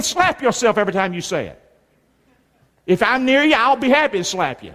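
ordinary talk

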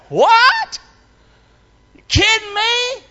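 high-pitched disbelief; incredulous. Acted. Preacher reacts to Peter being made leader, incredulous. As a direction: squawk it up high, astonished.

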